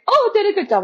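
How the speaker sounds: high-pitched imitation of her pleased past self; mock self-congratulation. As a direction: pitch it way up, mock proud of yourself. Acting out her naive past self.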